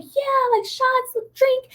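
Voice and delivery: high giddy party cheer; tipsy excited squeal. Retold or imitated. Friends cheering at strangers offering shots, high giddy squeal. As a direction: squeal it like a tipsy college girl out clubbing. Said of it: "we're like".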